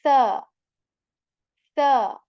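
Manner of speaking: "sa" loud, tongue tip on the teeth, no whistle. Shown as a demonstration. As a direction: hit "sa" crisply without whistle. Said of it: "we make the sound like".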